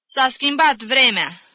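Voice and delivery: loud, high, slow complaint; hammed-up martyr pleased with her diagnosis; exaggerated suffering, self-satisfied. Sufferer announcing a weather change to the aunts, exaggerated suffering. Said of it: "cu suferințe exagerate și satisfacție științifică".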